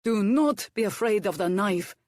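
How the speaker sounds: a hint of a gruff foreign cook; bossy kitchen instructions, lightly accented. A narrator's reading. Hercules instructing Violet at potatoes, lightly accented. Cook's advice.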